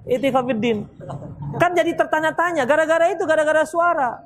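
normal talking voice

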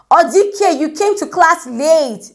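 high, slow stern lecturer call. Retold or imitated. Lecturer calls out the late student at the back, high and stern. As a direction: call her name like a lecturer spotting her late.

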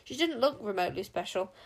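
ordinary delivery

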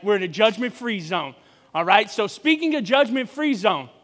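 ordinary talk, nothing marked

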